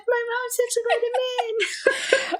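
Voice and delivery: mock child; kid voice. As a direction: whine it like a little kid. Kid's voice retold.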